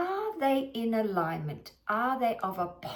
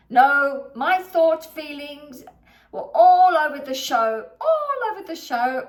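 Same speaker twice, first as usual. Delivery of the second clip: louder, higher insistence; emphatic; 5 semitones higher, 10 dB louder than usual. Real, not acted. Coach stresses her scattered thoughts, loud and emphatic. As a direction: drive the point home hard.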